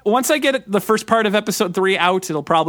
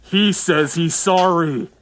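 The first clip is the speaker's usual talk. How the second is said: robot voice; much slower than usual. Retold or imitated. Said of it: "the robot goes".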